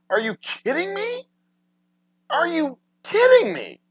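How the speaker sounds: can't believe they don't know; incredulous outrage. Real, not acted. Streamer to chat, disbelieving the pizza worker, incredulous.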